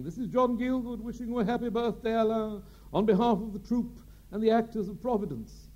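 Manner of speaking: high, grand theatrical voice; Gielgud's birthday message. Retold or imitated. Gielgud recording a birthday greeting for the director, grand and high. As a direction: intone it like a stately old stage actor. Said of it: "they wanted Gielgud to tape the first message".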